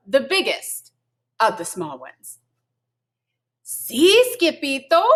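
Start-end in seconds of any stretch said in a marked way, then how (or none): 3.6-5.0 high, louder breathless little voice; panting. A narrator's reading. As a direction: gasp it out like the tiniest pup. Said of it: "panted Poquito Tito".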